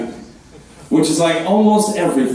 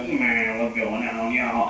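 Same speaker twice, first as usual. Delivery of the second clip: stoma voice imitation; 6 semitones lower, 8 dB quieter, slower than usual. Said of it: "a guy who had a stoma".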